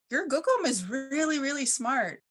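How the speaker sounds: little people's voice. Retold or imitated. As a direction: praise her gookum like a tickled little being. Little people praising grandmother.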